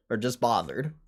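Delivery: ordinary delivery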